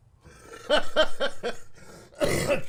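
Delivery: high, loud real laugh; loud burst of laughter. Real, not acted. Laughing at a friend's joke, a loud real laugh. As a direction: crack up out loud.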